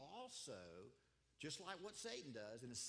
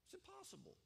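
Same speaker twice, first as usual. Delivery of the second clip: ordinary talk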